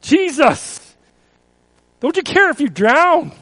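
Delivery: loud, high anguished plea; repeating the disciples' frightened question; pleading cry. Acted. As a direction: beg him to wake up and care.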